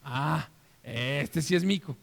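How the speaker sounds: slow, tender recognition; recognizing father. Acted. Father in the skit welcoming his real son, slow and tender. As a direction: sigh it out, finally relieved and pleased.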